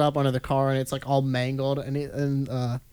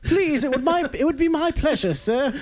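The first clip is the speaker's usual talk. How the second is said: high, overly polite British voice; bow and scrape in a mock British gentleman voice; British politeness; 11 semitones higher than usual. Puddle man offering himself as a walkway, high mock British courtesy. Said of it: "I don't know why he's British".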